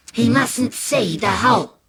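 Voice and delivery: ordinary delivery, nothing marked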